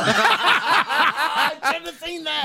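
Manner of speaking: laughing. Real, not acted. Laughing at inside joke.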